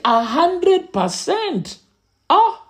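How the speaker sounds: high, slow, incredulous question; surprised father. Retold or imitated. Father hearing a classmate scored full marks, slow and incredulous. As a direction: stretch it out in disbelief.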